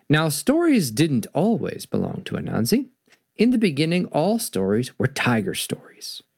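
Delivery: expressive storybook narration with voiced animals; story reading. A narrator's reading. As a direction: read to children, giving each animal a voice.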